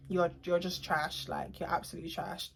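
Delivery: low, very soft, drained retelling; quiet contempt. Retold or imitated. Retold quiet verdict after calming down.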